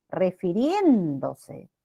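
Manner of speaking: "Refiriéndose" slowly, with RIEN stretched out; stress on RIEN. Shown as a demonstration. Teacher showing students the stressed syllable, RIEN drawn out in "Refiriéndose". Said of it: "rien es la sílaba tónica".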